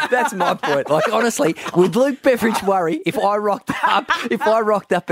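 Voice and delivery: high-pitched, incredulous and laughing; laughing protest. Real, not acted. Joking through laughter about secrecy.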